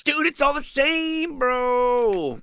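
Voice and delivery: high, loud, stretched-out bro shout; high-pitched mock freak-out with drawn-out words; hammed-up freak-out. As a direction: holler it in over-the-top amazement. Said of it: "I'm freaking out right now".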